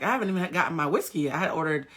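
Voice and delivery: usual speaking voice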